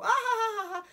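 high, loud cackling laugh; manic laugh. Retold or imitated. Tripping in the kitchen, bursting into high laughter. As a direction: let out a wild giggle. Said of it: "I start laughing".

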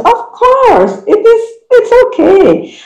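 high-pitched, emphatic encouragement; cheerleading a nervous learner, voice way up high; emphatic reassurance. Real, not acted. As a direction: reassure insistently, pitch soaring. Reassuring learners emphatically about accented English.

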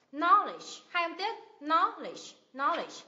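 KNOWLEDGE with a strong first syllable, falling away; first-syllable stress. Shown as a demonstration. Teacher models Knowledge for learners, stress on the first syllable. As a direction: punch the start of "Knowledge", let the ending drop. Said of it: "nhấn âm tiết thứ nhất".

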